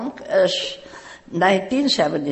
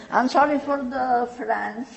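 normal talking voice

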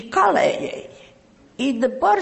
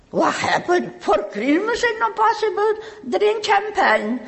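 high indignant disbelief; incredulous reaction; 11 semitones higher than usual. Retold or imitated. Reacting to her sponsor's first-drink rule, high mock indignation. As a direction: pitch up in mock outrage at no Christmas drink. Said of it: "My reaction is".

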